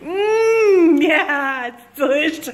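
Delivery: mock savoring. Malik giving in, tasting sweet.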